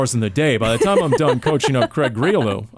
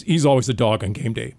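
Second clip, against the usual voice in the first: ordinary delivery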